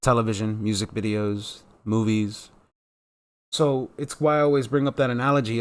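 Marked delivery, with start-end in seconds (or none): none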